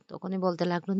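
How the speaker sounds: ordinary delivery, nothing marked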